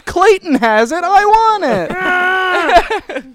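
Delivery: put-on whiny kid yell. Kid voiced yelling through phone.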